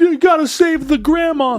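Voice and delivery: high, teary plea; weepy audience member. Retold or imitated. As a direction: beg through mock tears. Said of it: "guys are tearing up and like".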